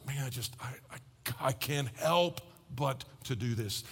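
soft, halting, almost breathless confession; a hushed, slow imitation of someone overcome by compassion; hushed inner voice. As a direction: murmur it as if moved despite yourself.

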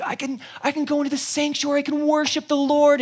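high, eager joy; bursting with thanks; healed leper rejoicing. Acted. Healed leper celebrating to the crowd, joyful.

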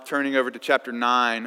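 normal talking voice

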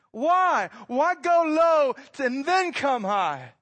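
high, loud and slow, driving each question home; preaching at full force. Real, not acted. Preacher pressing the congregation on why God came small, loud and slow. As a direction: climb to the pulpit's peak and hammer each why.

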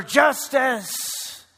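high, loud fan's shout; repeated crowd yell. Retold or imitated. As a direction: take up the cry from the stands. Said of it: "One after another".

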